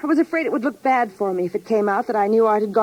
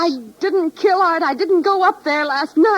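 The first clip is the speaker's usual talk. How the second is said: upset denial; 6 semitones higher, 4 dB louder than usual. Acted. Accused wife denies murder.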